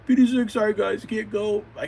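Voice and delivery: Petey, loud and high, in a hurry; Petey's voice. Acted. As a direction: dash off to the rescue. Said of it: "Petey the Plant Boy".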